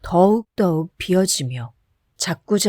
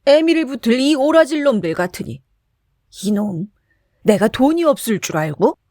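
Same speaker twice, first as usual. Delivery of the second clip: bursting with anger; 4 semitones higher than usual. A narrator's reading. Drunk Kim curses the servers, furious. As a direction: explode at them. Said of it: "화를 버럭 내며".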